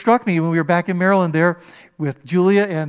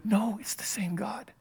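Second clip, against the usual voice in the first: hushed whisper; soft whispered insistence; 2 semitones higher, 11 dB quieter than usual. Real, not acted. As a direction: drop to a whisper. Emphatic aside.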